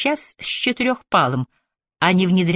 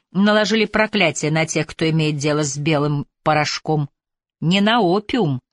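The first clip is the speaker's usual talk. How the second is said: plain reading voice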